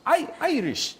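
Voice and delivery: high-pitched, perky Irish accent; exaggerated Irish accent. Shown as a demonstration. Host corrects himself after co-host's criticism, high Irish accent. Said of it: "there you go".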